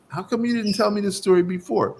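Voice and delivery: high, quick incredulous question; astonished protest. Retold or imitated. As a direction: act out a bewildered complaint. Acts out stunned question to master.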